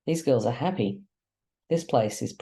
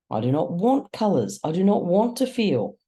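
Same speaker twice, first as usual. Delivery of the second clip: high, louder frightened protest; scared refusal; 7 semitones higher, 4 dB louder than usual. Acted. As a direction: Crow pushing feeling away in fear. Scared Crow refuses colours.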